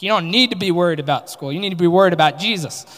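loud, fast, heated retort; angry outburst. Retold or imitated. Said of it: "I wanted to go off on her".

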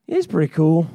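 low-pitched, unimpressed answer; lukewarm reply. Acted. Listener replying to an excited leader, lukewarm and low. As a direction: drop the pitch and concede with little interest. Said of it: "And you go".

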